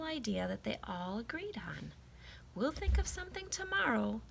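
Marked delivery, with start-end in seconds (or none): 2.5-4.2 put-on high, bright-eyed boy promising the class; a boy reassuring his friends, pitched well up; assuring kid voice. Eddie reassures classmates: high boyish promise. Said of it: "Eddie assured everyone".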